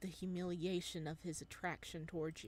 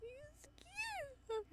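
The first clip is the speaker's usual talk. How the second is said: squealing; 6 dB quieter than usual. Real, not acted. Fangirl reaction.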